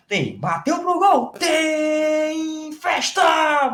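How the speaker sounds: high, drawn-out tem festa call; radio narrator bellowing a long drawn tem festa; stretched goal catchphrase. Shown as a demonstration. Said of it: "Vou moldar esse tem festa".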